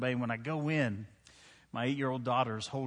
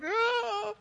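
put-on higher, whimpering baby wail; a brief hammed-up infant whine; mock baby cry. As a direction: imitate a baby crying for daddy. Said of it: "the baby's like".

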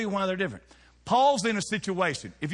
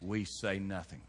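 hushed low statement; 8 semitones lower, 9 dB quieter than usual. Acted. Repeated line, quiet.

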